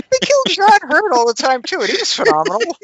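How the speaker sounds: high, loud, quickening defence; heated protest. Real, not acted. Defending an actor to a teasing friend, heated protest.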